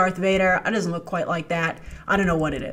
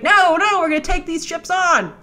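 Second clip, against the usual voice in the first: mock bold reply; 7 semitones higher than usual. Leaders telling the troops they'll take on the ships. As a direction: answer like a cocky leader. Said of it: "they're like".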